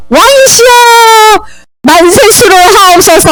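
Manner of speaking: high, stretched hail to the king; acted royal salute; ceremonial acclamation. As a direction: proclaim it grandly, drawing out the endings. People hail the new king.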